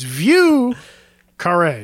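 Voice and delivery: "Vieux" drawn out, pause, then "carré"; "Vieux ... carré" slowly split in two; two separate words. Shown as a demonstration. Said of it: "almost sounds like one word when people say it".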